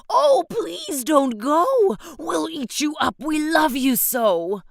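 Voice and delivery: wild things pleading. Acted. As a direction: beg him not to go, big and silly. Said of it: "the wild things cried".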